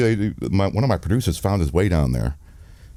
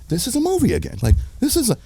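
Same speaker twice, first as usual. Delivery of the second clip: disbelief; faster than usual. Retold or imitated. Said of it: "And I'm like".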